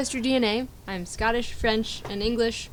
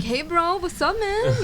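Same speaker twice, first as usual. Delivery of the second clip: a higher-pitched imitation of a Miami bro greeting; mock Miami accent; 9 semitones higher, 3 dB quieter, much slower than usual. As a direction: lightly ham up a Miami cousin on the phone. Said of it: "You get on the phone with them and you're like".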